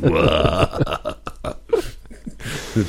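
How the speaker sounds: evil villain cackle, drawn out; diabolical laugh. Pretend. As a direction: laugh like a scheming sci-fi villain. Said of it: "Diabolisches Lachen und solche Sachen".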